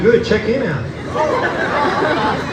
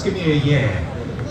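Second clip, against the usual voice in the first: drawn-out prompt; 6 semitones lower, 3 dB quieter, much slower than usual. Shown as a demonstration. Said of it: "give me a response".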